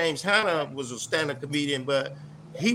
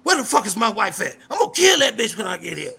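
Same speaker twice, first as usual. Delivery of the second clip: hammed-up angry shout, higher; mock shout; 5 semitones higher, 4 dB louder than usual. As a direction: bark the threat like a big raspy dude. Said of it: "one of his jokes would say".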